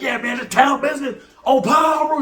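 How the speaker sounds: high-pitched hyped-up hollering imitation; mock urban shout-out. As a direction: mimic someone showing off their hometown. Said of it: "getting real urban".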